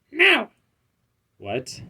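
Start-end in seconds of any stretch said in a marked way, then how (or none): 0.1-0.5 sharp, very high refusal; character voice. Acted. Gamer voices the pet refusing the villains, in a very high voice. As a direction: squeak the no up high. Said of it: "not doing the throat voice anymore".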